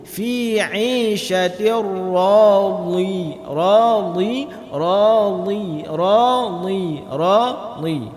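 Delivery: slow recited radi, dhad pressed with no breath; teacher drilling radi; dhad without air. Shown as a demonstration. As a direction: repeat radi, the dh firm and airless. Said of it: "tidak berangin".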